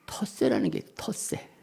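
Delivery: low, breath-only confiding whisper; hushed whisper. Real, not acted. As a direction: drop to a whisper as if sharing a secret. Confides a church's hidden territorialism. (비밀처럼 속삭이는 목소리)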